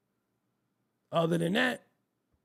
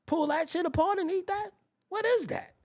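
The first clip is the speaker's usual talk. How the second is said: incredulous; 10 semitones higher than usual. Real, not acted. Disgust at chicken legs.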